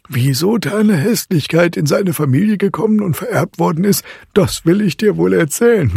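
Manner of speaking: put-on high, emphatic old storyteller figure; insistent godfather. A narrator's reading. Godfather offers Marie a tale, high and insistent. Godfather character holds forth.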